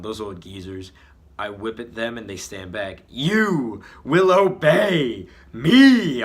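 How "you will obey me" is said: very loud, high, slow commanding shout; villain yell. Acted. Villain commanding the old geezers, slow loud yell. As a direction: drag out the command like a tyrant. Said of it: "I yell at them".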